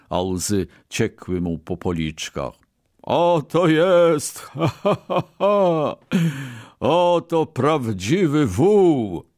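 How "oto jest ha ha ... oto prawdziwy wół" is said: high, loud, drawn-out guffawing; shaking with laughter. A narrator's reading. Merchant mocking the ox that would not pull, laughing hard. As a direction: crow it through big acted laughs. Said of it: "trząsł się ze śmiechu kupiec".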